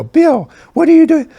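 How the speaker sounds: high-pitched, loud mock [name]; [name]'s high voice. Keyboardist imitating the singer stopping the band, high squeak. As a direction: squeak it out like a startled star. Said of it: "I'm gonna try not to imitate [name]'s voice".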